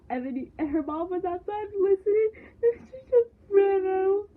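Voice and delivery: crying while telling it, words breaking up; tearful. Real, not acted. Retelling a sad TV scene to viewers, choking up and crying. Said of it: "I'm getting emotional".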